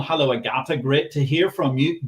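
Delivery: ordinary delivery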